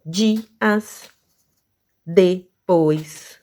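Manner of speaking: each word slowly split into two beats; level, separated syllables, word after word. Shown as a demonstration. Teacher listing two-syllable words, each slowly broken in two. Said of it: "aqui nós temos".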